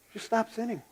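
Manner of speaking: quietly, almost under the breath; a hushed plea: just stop sinning; soft repeat. Shown as a demonstration. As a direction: let it drop soft and gentle. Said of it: "And I know I say".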